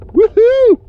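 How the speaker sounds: very high, loud whoop; excited whoop. Real, not acted. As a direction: celebrate the catch. Fish landed, cheering.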